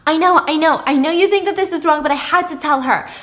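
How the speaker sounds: high, loud self-justifying; defensive pleading. Acted. Pippa defending herself to upset Thomas, loudly defensive. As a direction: insist you had no choice.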